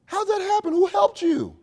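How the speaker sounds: a manager hearing terrible news, voice jumping up; retold alarmed disbelief, pitched high; shocked manager. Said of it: "Missy was like".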